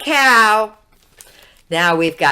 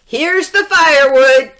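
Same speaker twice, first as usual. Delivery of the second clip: loud, higher excited call; shouted; 6 semitones higher, 7 dB louder than usual. A narrator's reading. Jack bringing the firewood to his mother, shouting. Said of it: "shouted Jack".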